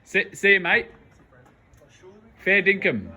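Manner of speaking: hammed-up Aussie farewell, high and loud; Australian accent. Vlogger sends friends off to America in a mock Australian accent. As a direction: play the broad Australian bloke saying goodbye. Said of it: "put on the Australian accent".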